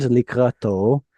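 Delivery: likrato with the stress on the last syllable, to; slow and careful, landing the stress on the final to of likrato; stress at the end. Shown as a demonstration. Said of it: "the stress is at the end".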